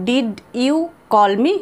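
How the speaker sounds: unhurried, each word clear; slow. Shown as a demonstration. Teacher modelling the question slowly for students. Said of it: "তখন আমরা এইভাবে বলবো".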